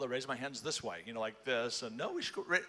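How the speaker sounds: ordinary talk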